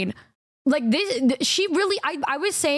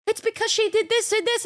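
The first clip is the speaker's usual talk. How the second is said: higher, insistent mock of Michi; 9 semitones higher than usual. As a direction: play her stubborn excuse pitched up. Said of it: "she's like".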